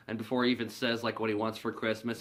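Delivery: ordinary talk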